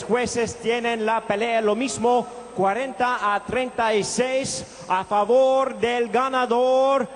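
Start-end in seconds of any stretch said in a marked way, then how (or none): none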